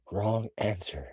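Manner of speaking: quiet, menacing killer; lightly voiced masked killer; Ghostface voice. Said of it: "he answered as his voice".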